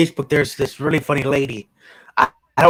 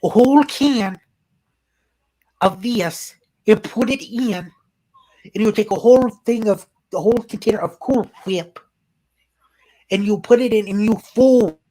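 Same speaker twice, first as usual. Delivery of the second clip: put-on higher, sing-song Minnesota mom accent; 6 semitones higher than usual. Imitating a Facebook mom's Minnesota accent giving a recipe. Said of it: "that Minnesota slash North Dakota accent".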